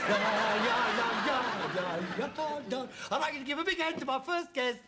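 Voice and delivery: mock show host with sung fanfare; hammed-up guest introduction, pitch climbing on the name; Henry the Eighth. Son spoofing dad's seance as guest night, showy host. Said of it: "tonight is my guest night".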